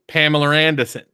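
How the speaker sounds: "Pamela Anderson" with the r moved, Maine style; Maine accent. Shown as a demonstration. Showing co-hosts how Mainers say it, Maine accent. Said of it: "people in Maine would go".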